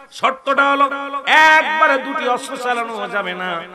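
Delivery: high, loud fiery sermon voice; preaching at full force. Acted. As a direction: preach it out with fire. Preacher in full force telling story.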